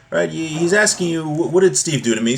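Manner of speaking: normal talking voice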